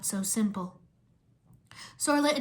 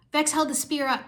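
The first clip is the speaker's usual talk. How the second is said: ordinary delivery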